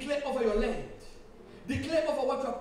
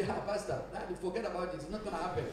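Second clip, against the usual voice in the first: low, quiet dismissive mock reply; negative doubter; 5 semitones lower, 8 dB quieter, faster than usual. Imitating people who doubt the pastor, low dismissive voice. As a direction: voice a doubter brushing it off softly. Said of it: "people are always negative about that".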